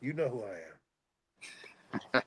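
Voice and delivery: very low, slow, hushed reply; suggestive. Real, not acted. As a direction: draw it out softly and intimately, deep in pitch. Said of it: "the way you said that".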